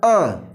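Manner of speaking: a drawn-out short vowel "अ", falling; 'अ' का उच्चारण. Shown as a demonstration. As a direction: demonstrate the letter sound "अ" on its own. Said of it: "इसका उच्चारण होता है". ('अ' की ध्वनि का उच्चारण)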